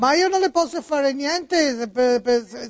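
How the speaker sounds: put-on Italian spoken with an American accent; high-pitched American notary on the phone. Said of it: "come parla Niculo? ... oggi americani".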